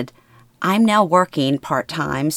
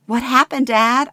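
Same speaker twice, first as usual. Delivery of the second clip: higher, urgent frightened question; panicked; 8 semitones higher, 4 dB louder than usual. Acted. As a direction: ask in sudden panic. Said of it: "I panic".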